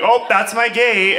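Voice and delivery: high, loud, drawn-out exclamation; mock excited recognition. Traveler spotting fellow Iowans at the airport, mock excited. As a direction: call it out like a comic discovery. Acting out spotting her gate.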